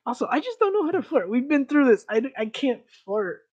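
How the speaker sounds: high, loud, hurried protest; flustered self-defense. Real, not acted. Defending himself to a teasing friend about flirting, flustered. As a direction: protest your own hopelessness, voice shooting up.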